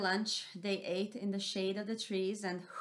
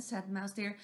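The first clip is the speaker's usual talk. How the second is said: plain reading voice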